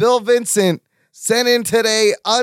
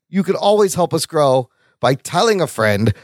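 ordinary talk, nothing marked

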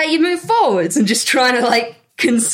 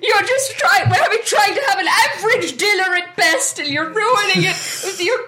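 higher, offended huff at the dinner table; indignant outburst; 6 semitones higher than usual. Acted. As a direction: snap back, theatrically wounded. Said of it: "slams down her hand".

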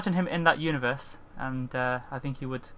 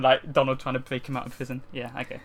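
normal talking voice

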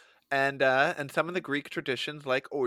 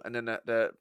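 ordinary talk

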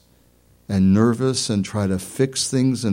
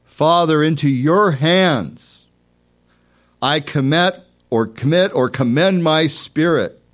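loud cry; 8 semitones higher, 7 dB louder than usual. Retold or imitated. Preacher voices Jesus crying out to the Father, loud cry. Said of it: "he cried out in a loud voice".

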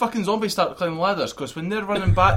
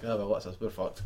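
resigned; 7 semitones lower, 10 dB quieter than usual. Retold or imitated. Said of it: "it's just sort of like".

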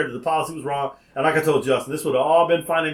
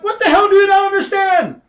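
loud, high-pitched retold yell; exasperated outburst; 6 dB louder than usual. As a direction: blow up at a clueless employee. Acted quarrel with pizza employee.

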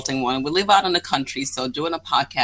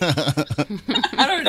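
laughing; 3 semitones higher, much slower than usual. Real, not acted. Laughing at the joke.

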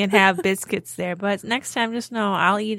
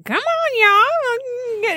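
very high hillbilly cartoon holler; hillbilly doll; 14 semitones higher, much slower than usual. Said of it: "hillbilly doll on, uh, Aqua Teen Hunger Force".

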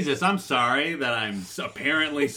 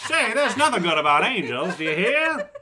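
put-on town drunk; 9 semitones higher, 5 dB louder than usual. Improvised movie scene, town drunk.

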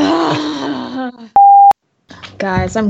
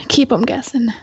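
ordinary talk

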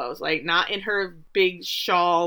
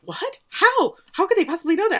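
much higher, squeaky astonished quote; shocked disbelief; 11 semitones higher, 4 dB louder than usual. Retold or imitated. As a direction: gasp it out as if caught off guard. Shocked friend in retold chat.

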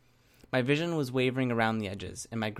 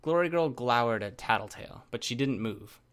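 ordinary delivery, nothing marked

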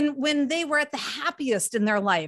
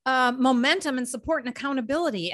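ordinary delivery

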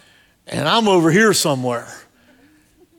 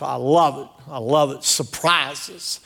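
normal talking voice

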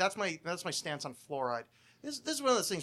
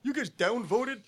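higher, louder mock smug voice; smarmy mockery; 7 semitones higher, 6 dB louder than usual. Mocking smug atheists about fluoride, smarmy voice. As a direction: sneer at them like a know-it-all.

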